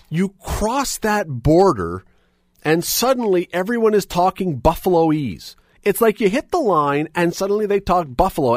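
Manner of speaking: lightly done Buffalo accent; bad Buffalo accent. Shown as a demonstration. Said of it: "a really terrible attempt at doing the Buffalo".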